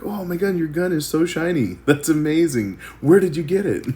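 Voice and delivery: hammed-up cheery victim with laughs; upbeat movie guy. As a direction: gush over the shiny gun. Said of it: "just to be that guy".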